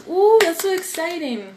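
high, bright burst of excitement; excited. Real, not acted. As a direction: gush with delight for the new learner. Reacting to a new Spanish learner.

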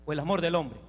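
low, dropped-away question; dismissive alternative. Acted. Preacher offers the young women man's love, dismissive alternative. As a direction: let the second option sink down low. Deflated rival option to God's love.

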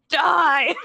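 loud mock death cry from an unseen voice; mock commanding voice. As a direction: belt it out as a joking command. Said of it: "I hear a voice to my right say".

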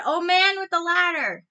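high, loud calling shout. A narrator's reading. Chang shouts to the old man with his last breath. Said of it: "he shouted".

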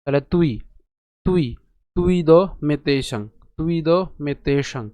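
তুইদমেতেষাং split as tui-do-me-te-sham; slow, split তুইদমেতেষাং; pronunciation demo. Shown as a demonstration. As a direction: say তুই with a clear u, then দ মেতেষাং slowly. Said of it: "উ এর মতো হয়".

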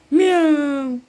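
a loud coaxing meow, falling. Pretend. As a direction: call the cat with a big meow.

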